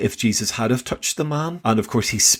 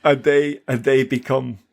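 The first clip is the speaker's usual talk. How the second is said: emotional. Real, not acted. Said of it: "I'm getting very emotional".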